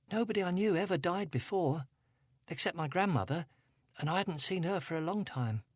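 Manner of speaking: Angela, a hint of upset teen; teenage girl. A narrator's reading. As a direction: voice her as a troubled teenage girl. Angela voiced.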